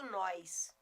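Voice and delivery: NÓS with a glide, "nóis"; Goiás regional pronunciation. Shown as a demonstration. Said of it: "por exemplo, eu sou goiana".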